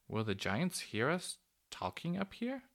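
understated nervousness; anxious. A narrator's reading. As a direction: ask with a touch of anxiety. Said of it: "Mary anxiously".

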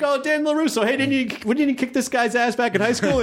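high-pitched mock recognition; excited party-goer. Greeting someone at a party in the trailer, high excited retelling. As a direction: act out an excited acquaintance, pitched well up.

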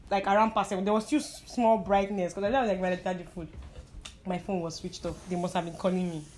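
ordinary talk, nothing marked

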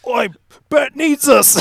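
high, loud Cockney "Oi, Bert needs us"; chimney sweep rallying the gang in mock Cockney; mock Cockney call. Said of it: "It's like".

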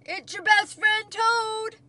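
high, loud calling voice; Toad's shout. A narrator's reading. Toad tries to reach Frog across the river, shouting. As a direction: holler to be heard far off. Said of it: "he shouted".

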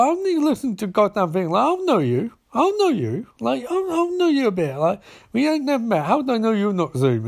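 high-pitched mock outrage; indignant. Barry quarrels in acted dialogue.

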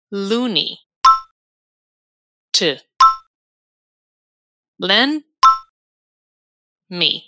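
each word separate, long pauses after it; slow teacher's drill, one word at a time; slowly, word by word. Shown as a demonstration. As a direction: break the sentence into single words for repetition. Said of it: "Vamos repetir devagarinho?".

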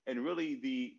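normal talking voice

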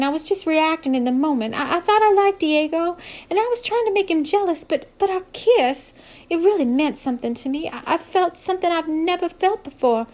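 high, stammering apology; flustered young woman. Acted. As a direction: tumble out a nervous sorry. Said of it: "She stammered a little".

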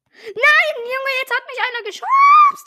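very loud, high furious yell; angry shout. Real, not acted. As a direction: scream in outrage. Pushed by another player.

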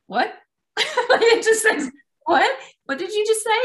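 high, laughing "what?" of disbelief; indignant surprise. Retold or imitated. Her inner voice catching her own insult, laughing in surprise. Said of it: "I have this thing that goes".

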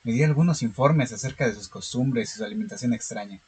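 calm, steady horror story reading; story narration. Acted. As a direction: read it like a measured horror tale. (lectura de cuento de terror)

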